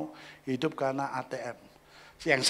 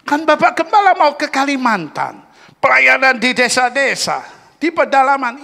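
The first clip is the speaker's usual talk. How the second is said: eager member voice; 12 semitones higher, 15 dB louder than usual. Retold or imitated.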